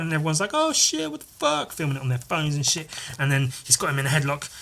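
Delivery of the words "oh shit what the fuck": very high mock alarm; shocked crowd. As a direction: squeal it like stunned bystanders. Everyone's shocked reaction, acted.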